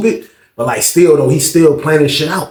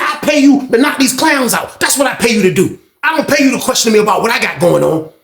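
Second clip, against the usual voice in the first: high-pitched indignant telling-off; heated, raised-pitch rebuke; irate retold scolding; 7 semitones higher than usual. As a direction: lay into him like a fed-up boss. Retold angry boss scolding worker.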